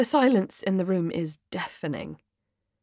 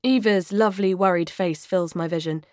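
plain reading voice

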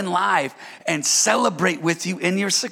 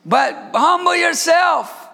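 slow, loud, high preacher shout; 8 semitones higher, 3 dB louder, slower than usual. Acted. Pastor shouting the point to the church. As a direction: drive it home with a shout. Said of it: "this isn't a shouting message".

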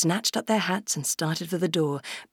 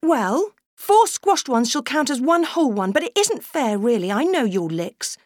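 put-on high prim little girl laying down rules; severe; 7 semitones higher, 4 dB louder than usual. Sister ruling on berries, mildly severe. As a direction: lay down the law like a stern small girl. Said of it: "Barbara looked severe".

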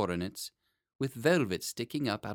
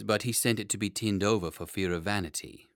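ordinary delivery

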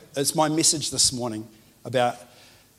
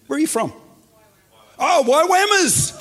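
excited shout; 14 semitones higher, 8 dB louder than usual. Real, not acted. Preacher greeting visitors from Waiwammers: delighted shout. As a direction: call out the town name with joy.

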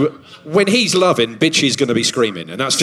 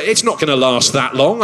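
ordinary talk

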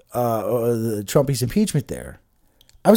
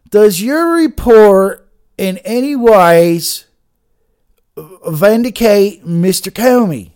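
loud, high, drawn-out Southern drawl; slow Southern senator voice, projected loudly; 6 semitones higher, 9 dB louder, slower than usual. Acted. Host mimicking Senator Kennedy questioning Horowitz, broad Southern drawl. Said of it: "I can do a Southern voice".

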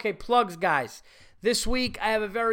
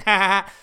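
loud imitated ha ha ha laugh; Brewer's laugh; 3 semitones higher, 11 dB louder, much faster than usual. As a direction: bark out Brewer's typical laugh. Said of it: "did his typical laugh".

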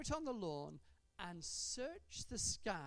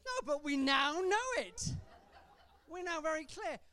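loud laughing reply; 8 semitones higher, 9 dB louder than usual. Real, not acted.

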